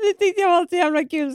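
laughing. Real, not acted. Laughing at own prank memory.

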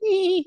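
a quick pet squeak; high hamster squeak. Pretend. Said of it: "Did he make that noise?".

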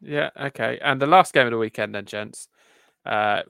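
normal talking voice

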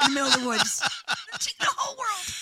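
high, squeaky disbelief; high incredulous. Retold or imitated. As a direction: pitch it way up in disbelief. Continuing mock high voice.

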